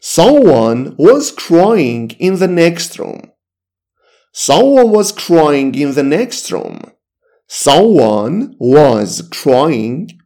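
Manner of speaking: very slow, each word spaced out; slow teaching demo; slow model sentence. Shown as a demonstration. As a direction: read it word by word for learners.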